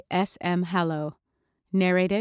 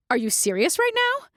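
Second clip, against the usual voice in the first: indignant disbelief; 11 semitones higher than usual. Retold or imitated. Mandy finds cat at home.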